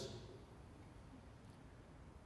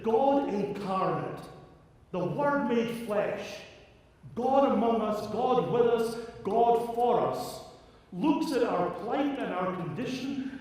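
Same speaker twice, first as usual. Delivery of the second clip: building sermon climax; 6 semitones higher, 4 dB louder than usual. Acted. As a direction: build it up phrase by phrase. Preaching crescendo.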